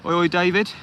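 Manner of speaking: high, loud sing-song oi oi; cheery greeting call. Real, not acted. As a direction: call out to welcome a regular. Greeting a viewer on live stream.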